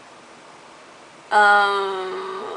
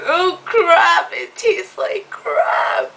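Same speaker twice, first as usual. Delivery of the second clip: disgusted outcry; 9 semitones higher, much slower than usual. Retold or imitated. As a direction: yell it like the drink burns. Reacting to strong drink.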